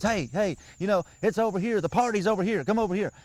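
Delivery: a hen turkey inviting the flock, lightly voiced; excited hen turkey. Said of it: "She's, she's telling these other hens".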